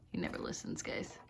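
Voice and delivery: whispered confidingly to the camera; soft unvoiced aside. Real, not acted. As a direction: let the viewers in on it quietly. Aside to viewers.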